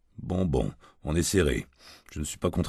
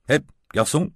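a raised call across the bar; calling the waiter; 10 semitones higher, 4 dB louder than usual. A narrator's reading. Drunk client calls the waiter over the bar noise, raised call. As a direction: sing out for the waiter.

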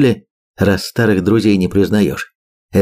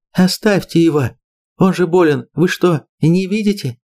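desperate plea; 9 semitones higher than usual. Acted. Lera pleading for grandfather.

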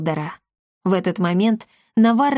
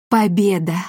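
mildly breathed-out exclamation; exhaled. A narrator's reading. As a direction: let it out on a sigh of relief. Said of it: "выдохнул Гомет".

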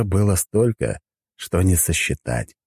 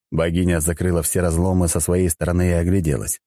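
plain reading voice

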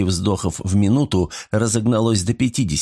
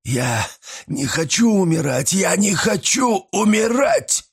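rising panicked shout, much higher; terrified scream; 10 semitones higher than usual. A narrator's reading. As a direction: scream it out in terror. Terrified killer begs for life.

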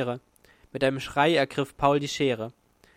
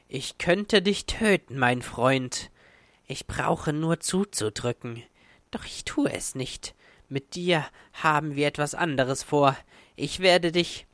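menacing monster's hissing voice; hoarse hiss. A narrator's reading. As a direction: hiss the threat hoarsely, partly whispered. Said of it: "Sie war mehr ein heiseres Zischen". (heiseres, drohendes Zischen)

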